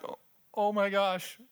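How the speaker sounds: a hint of a startled daughter; imitated teenage daughter. Daughter reacting, retold.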